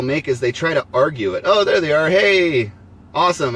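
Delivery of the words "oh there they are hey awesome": excited. Real, not acted. Viewers finally show up on screen.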